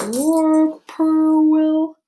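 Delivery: high, slow and sing-song; drawn-out whirling voice. Shown as a demonstration. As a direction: stretch it like a swirling call. Imitating bird call.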